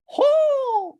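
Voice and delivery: very high eagle shriek; shrieking giant bird. Retold or imitated. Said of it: "That's my Eagle impression".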